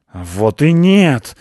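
loud, slow, outraged denial; indignant shout. A narrator's reading. Ryzhopius shouts an indignant denial at the cat. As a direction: yell it back in outrage. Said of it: "возмущённо закричал Рыжопиус".

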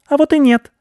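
very high, loud cheeky squeak; triumphant little mouse retort. A narrator's reading. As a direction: pipe back defiantly as a tiny mouse. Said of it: "пискнул мышь".

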